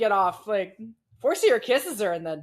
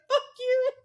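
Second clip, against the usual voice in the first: mock outraged cry. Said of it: "And you're like".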